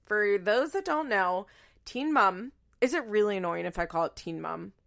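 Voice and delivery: usual speaking voice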